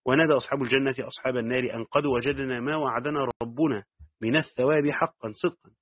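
usual speaking voice

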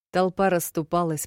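plain reading voice

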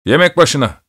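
high-pitched call to everyone; shouted summons. A narrator's reading. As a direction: call it out like an order. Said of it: "diye bağırdı".

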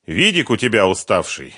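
pleased with himself, voice lifted and loud; smug, pleased. A narrator's reading. Фёдор to the returning runaway Сухарев, pleased. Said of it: "довольным голосом сообщил".

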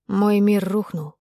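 slow, heavy with bitterness; bitter. Acted. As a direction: let the words sink slowly. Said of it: "ощутила на себе всю горечь".

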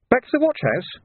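high-pitched eager junior voice; young constable. A narrator's reading. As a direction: report to the sergeant in a high voice. Young constable questions sergeant.

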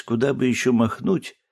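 usual speaking voice

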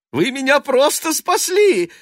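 very high, loud exclaiming voice; excited cry. A narrator's reading. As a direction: cry it out in giddy relief. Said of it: "возбуждённо кричал он".

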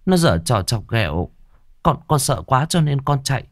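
put-on high, stammering timid girl's voice; frightened girl. Girl stammers her excuse to the stepmother, afraid. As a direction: stumble over the words in fear.

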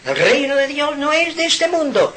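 high, lightly mocking preachy tone; a hint of a pompous cleric, high-pitched; mock church voice. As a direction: imitate the church's smug proclamation. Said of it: "Y han dicho".

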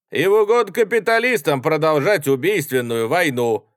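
loud, high haranguing speech; rally oratory. A narrator's reading. Young soldier harangues the carriage about enemies, declaiming. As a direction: declaim accusations to the crowd.

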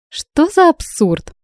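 high, drawn-out exclamation; incredulous. A narrator's reading. Bride dismissing the kidnapping charge, incredulous. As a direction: stretch it out in disbelief, pitched well up.

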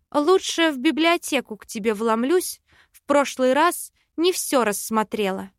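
usual speaking voice

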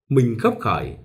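usual speaking voice

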